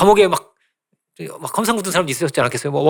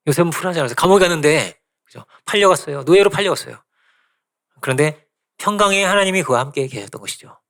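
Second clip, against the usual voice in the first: normal talking voice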